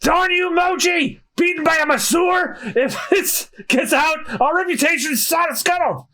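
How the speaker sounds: high-pitched, outraged pirate shouting; yelling captain. Acted. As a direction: yell orders at a disgraced crew. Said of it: "While they're all yelling".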